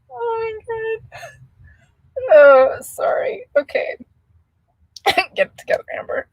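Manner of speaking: high, slow, breathless wheeze of laughter; tears of real laughter; crying with laughter. Real, not acted. Gasping after a viewer's joke, crying laughing. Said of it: "my face is all red and I'm crying".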